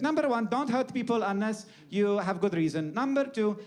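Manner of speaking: hammed-up old-world accent, lifted pitch on each rule; comic ancient lawgiver; Hippocrates. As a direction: proclaim the rules in a mock foreign accent. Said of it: "you can still do my accent".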